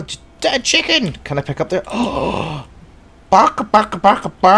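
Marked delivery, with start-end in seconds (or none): 3.3-4.5 loud mock barking. Imitating a dog.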